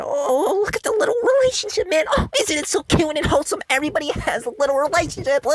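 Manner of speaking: high fast cutesy mock voice; mocking author. Vlogger mocking the author's cutesy romance, high and fast. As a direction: coo it like a sappy author. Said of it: "fucked around and said".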